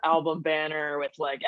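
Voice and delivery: ordinary talk, nothing marked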